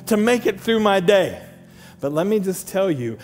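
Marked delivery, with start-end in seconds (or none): 0.0-1.4 protesting objection. Acted. Husband objecting to pastor again.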